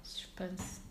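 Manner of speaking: breathy whispered title. Pretend. Friend teasing the topic, whispered. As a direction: hush it like a teaser.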